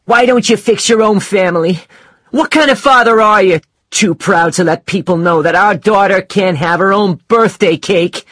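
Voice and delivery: high, loud accusing tirade; wife's loud, high, angry outburst; angry shouting. Acted. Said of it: "she was shouting at Mike".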